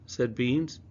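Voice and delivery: ordinary delivery, nothing marked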